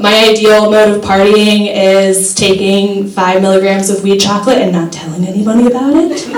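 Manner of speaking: ordinary delivery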